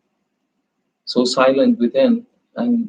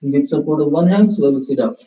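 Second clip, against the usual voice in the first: yoga teacher guiding the final rest; guided relaxation; 5 semitones higher than usual. Acted. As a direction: ease the class out of rest, unhurried. Leads closing relaxation.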